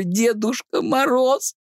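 high, slow, laughing fake cry; laughing mock cry. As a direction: cry out while cracking up. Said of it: "Я смеюсь, а как будто, ну, делаю вид, что плачу".

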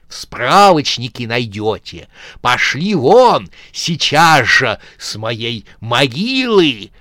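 slow, loud furious shout. Acted. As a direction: chase them off his grave. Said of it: "от ярости сжал челюсти".

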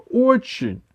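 very high, heartfelt admission; fervent. A narrator's reading. Grandmother confessing how much she wants it, fervent. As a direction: confess it wholeheartedly. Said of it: "горячо призналась бабушка".

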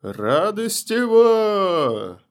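long high drawn-out yell; yell across the ward. Acted. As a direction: bellow it across the whole ward. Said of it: "завопила на всю палату".

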